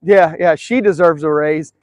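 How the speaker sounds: normal talking voice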